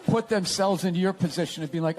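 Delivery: normal talking voice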